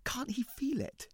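desperate breathy whisper. Acted. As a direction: whisper it to yourself. Desperate inner question.

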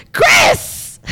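very high, loud cry out; anguished yell. Retold or imitated. Monster calls to his runaway gun, anguished yell. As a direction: scream the name in mock anguish. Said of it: "Testicle Time Monster yells".

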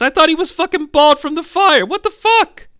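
very high-pitched disbelief; incredulous outburst. Acted. Reacting to the burned boy having hair, high incredulous squeal.

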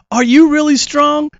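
put-on high, eager child's voice; eager kid question. Boy asking the Six Million Dollar Man about his strength, high and eager. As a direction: ask it wide-eyed like a small boy. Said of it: "I said".

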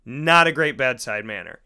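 ordinary talk, nothing marked

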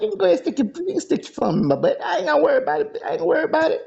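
high-pitched quote through laughter; laughing retelling. Retold or imitated. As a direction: crack up while quoting yourself. Retells own quote while cracking up.

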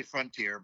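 "Frontier" with FRUN rhyming with "fun"; FRUN-tier pronunciation. Shown as a demonstration. Correcting the friend, "Frontier" as frun-teer. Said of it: "me and Matt would say".

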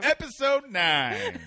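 high, loud, slow announcer voice; announcer intro. Pretend. Host announcing the episode to listeners, high slow announcer voice. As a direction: drag it out like a show announcer.

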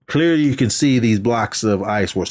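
usual speaking voice

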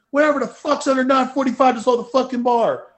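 loud, high, fast re-enacted outburst; pissed off. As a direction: fire it off like an angry lifter backstage. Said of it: "that's how I really was talking at the show. I was pretty pissed off".

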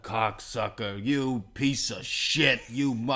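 slow, drawn-out mock insults; rude cop. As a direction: hurl each insult slowly, imitating an abusive cop. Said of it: "talk to each other very rudely".

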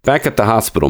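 ordinary delivery

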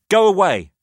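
high, loud go away; shouted. A narrator's reading. Narrator shouting at Jamie through the door, loud. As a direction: shout him off. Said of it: "I shouted".

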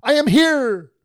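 high, very loud exclaimed call; exclamation-point shout. Retold or imitated. Jesus announcing himself to the disciples, exclaimed shout. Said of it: "Exclamation point".

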